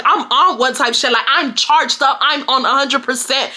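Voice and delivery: ordinary delivery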